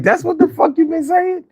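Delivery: high, mock-stunned voice; imitating stoned amazement. Recalling his first high to a friend, mock-stunned and high. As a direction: act out the dazed discovery. Retold own reaction to reggae.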